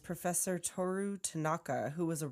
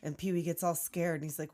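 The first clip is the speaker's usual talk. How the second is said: ordinary talk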